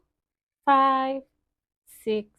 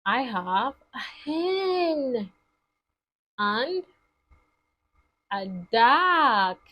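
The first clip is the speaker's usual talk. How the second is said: ordinary delivery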